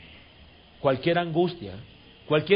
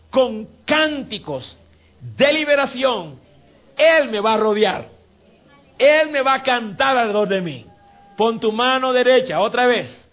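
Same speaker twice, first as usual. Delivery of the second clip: high, loud, fervent proclamation; preaching at full force; 7 semitones higher, 8 dB louder than usual. Acted. Pastor rousing the church over music, full-force preaching. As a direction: cry it out to the whole congregation.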